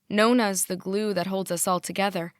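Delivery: ordinary delivery, nothing marked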